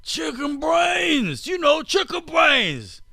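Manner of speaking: high, slow, hammed-up Australian yell; over-the-top Australian caricature, shouted high; mock Australian accent. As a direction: belt it out like a cartoon Aussie bloke. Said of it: "that's how a guy from Australia sounds to me".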